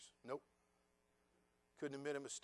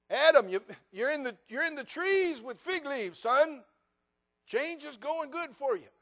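higher, loud mock-cheerful scolding; God teasing Adam in the trees; God's sarcastic rebuke; 11 semitones higher, 10 dB louder than usual. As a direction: needle Adam, slow and booming.

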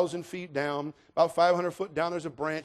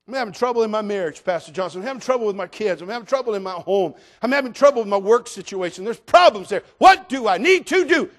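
louder, mounting complaint building to a shout; pleading congregant; 5 semitones higher, 11 dB louder than usual. Acted. Congregant to pastor about home troubles, mounting plea. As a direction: pile up troubles, ending in a loud plea.

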